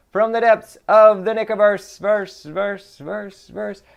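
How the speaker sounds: high echoing voice that fades away; echo effect. Acted. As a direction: repeat the word like a fading echo. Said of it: "echo effect on Nickiverse".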